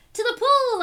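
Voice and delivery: put-on boy yelling. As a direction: shout it back eagerly. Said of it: "I yelled".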